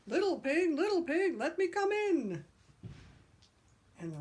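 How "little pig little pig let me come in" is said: high, loud sing-song wolf call; wolf knocking at the house of sticks; big bad wolf call. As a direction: call out teasingly at the door. Wolf calling at second house.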